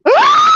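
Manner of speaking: sharp gasp into a high loud scream; gasp, then let out a piercing shriek. Retold or imitated. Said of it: "I said".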